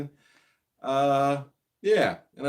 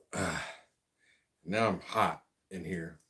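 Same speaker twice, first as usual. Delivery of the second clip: normal talking voice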